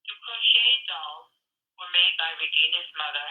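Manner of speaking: normal talking voice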